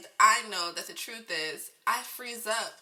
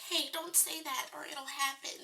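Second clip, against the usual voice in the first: higher, softer mock-scolding family voice; imitating her family; 7 semitones higher, 6 dB quieter than usual. Vlogger mimicking her superstitious family, mock-scolding and hushed. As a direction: pitch up and hush it like a fretting relative. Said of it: "I have a family that's like".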